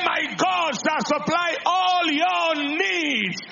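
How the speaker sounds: high, loud and slow, like a man declaring his faith; proclaimed shout. Retold or imitated. Man answering the doubter with scripture, slow high shout. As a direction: shout it out slow, every word a banner.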